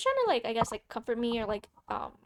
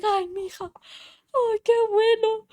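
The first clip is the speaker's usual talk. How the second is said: higher, slow, near-crying mother; mock tearful Mexican mom; relieved mom; 10 semitones higher, 7 dB louder, slower than usual. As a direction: voice Mom choking up with joy.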